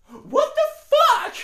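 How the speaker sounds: very high, drawn-out scream; slow, screeching mock outrage; outraged yell. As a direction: explode in hammed-up disbelief. Mock outrage at character's early death.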